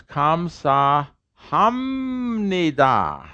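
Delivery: slow "Kamsa Hamnida" with a long held "mmm" in "Hamnida"; nasalized P demonstration. Shown as a demonstration. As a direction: stretch the M of "Hamnida", sounding every syllable. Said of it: "A P nasalized becomes an M".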